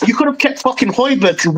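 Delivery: normal talking voice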